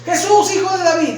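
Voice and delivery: high, urgent mock cry; re-enacted shout, pitched well up. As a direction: call out as the blind beggar. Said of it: "Y empieza a gritar".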